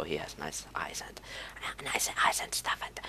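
soft breathy whisper; teasing whisper. Pretend. Gamer whispers playfully to viewers about the sexy Pyro. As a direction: whisper it like a silly secret.

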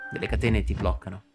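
ordinary delivery

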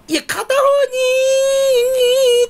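very high, slow, loud wailing mock; mocking classmate. Girls mocking his lopsided whistle, wailing mock shout. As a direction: drag it out crying and shouting. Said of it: "バカにされてさ".

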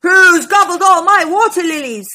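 loud, high-pitched dinosaur roar; big storybook roar; indignant roar. A narrator's reading. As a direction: roar the accusation for young listeners. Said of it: "roared Stegosaurus".

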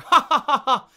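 loud, high laughing "ha, ha". Shown as a demonstration. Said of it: "here's how I laugh".

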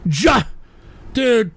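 usual speaking voice

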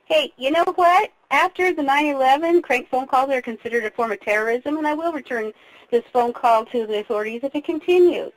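ordinary delivery

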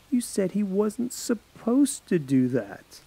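slow, level and pointed; girl calmly catching her friend's lie; cold, slow insistence. Acted. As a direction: lay out his words back to him, slowly. Paige coldly pressing Alex.

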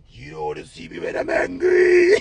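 strained, put-on menacing voice; hammed-up mock threat; angry man. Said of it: "what kind of angry man this".

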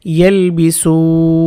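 ইয়ালবিসুন drawn out slowly, nun held long at the end; slow recited word. Shown as a demonstration. Teacher reciting ইয়ালবিসুন slowly to students. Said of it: "উচ্চারণ হবে".

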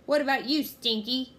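commanding pirate shout; roaring. A narrator's reading. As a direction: roar a question up at the crew. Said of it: "Captain Bones roared".

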